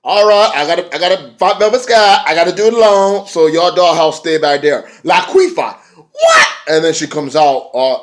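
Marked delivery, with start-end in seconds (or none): 0.0-4.8 high, loud sassy mock of the wrestler; imitating Kong. Retelling the match, voicing Kong taunting Velvet, high and loud. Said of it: "Awesome Kong was like". 5.0-6.5 laughing, high squeal of a name; mock call out. Retelling the match, calling out with a laughing squeal. Voicing a wrestler shouting.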